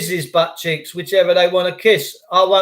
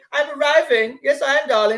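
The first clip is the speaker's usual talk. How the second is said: posh voice; 7 semitones higher than usual. Retold or imitated. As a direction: play a snooty aristocrat arriving. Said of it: "That's what posh people say".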